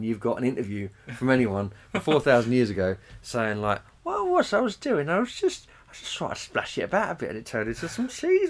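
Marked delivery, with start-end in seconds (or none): 4.0-8.5 high, squeaky put-on voice; silly high voice. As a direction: squeak it out like a daft ancient. Voicing ancient cheesemaker, high put-on.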